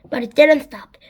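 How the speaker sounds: plain reading voice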